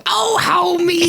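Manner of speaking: very high, slow, shouted cartoon call; Homer-style wife. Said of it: "reply and go".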